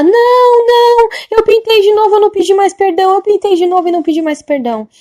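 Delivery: loud high cry that gradually falls; imitated despairing wail. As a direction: wail the confession in despair, then sink. Woman admits guilt in despair. (imitando choro de desespero, caindo aos poucos)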